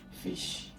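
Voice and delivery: fish whispered, short i stressed; short vowel demo. Shown as a demonstration. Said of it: "Short E sound".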